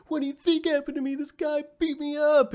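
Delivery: high, strained mock-crying voice; hammed-up tearful wail of a woman; mock bawling. Natalie fakes tears to manipulate him, mock bawling. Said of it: "and she's bawling".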